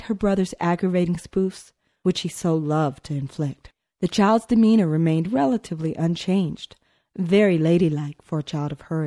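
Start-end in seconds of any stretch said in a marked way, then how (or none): none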